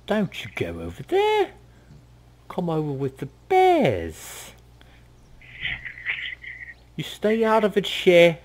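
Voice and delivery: high, bossy warning from the bear woman. Acted. Bear woman warns the group off, high and bossy. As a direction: shoo them toward the bears.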